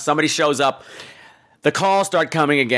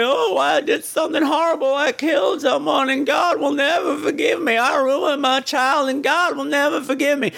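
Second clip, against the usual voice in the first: much higher, wailing mock shout of guilt; hammed-up confession; 10 semitones higher than usual. Shelley Winters confessing to the radio preacher, hammed-up wailing shout. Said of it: "She's like".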